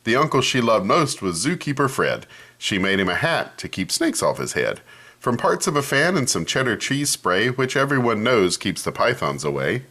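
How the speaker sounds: ordinary delivery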